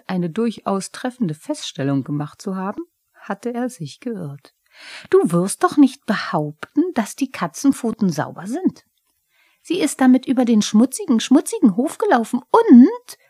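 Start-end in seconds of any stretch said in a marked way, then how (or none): 5.1-13.2 higher, louder cheeky kobold voice; a huffy little goblin scoffing at the cat; Pumuckl the kobold. Acted. Pumuckl regt sich auf.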